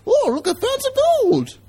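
very high cartoon old-timer; put-on falsetto old-timer voice; old Prospector. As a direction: squeak it high like a gold-struck prospector. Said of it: "And it's this voice".

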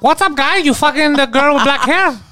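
dad impression. Imagined dad shows up.

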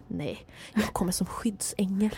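soft, slow, unvoiced tearful whisper. Old lady whispering to the worker that she'll return as a guardian angel. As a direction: breathe it out, moved almost to tears.

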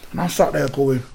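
normal talking voice

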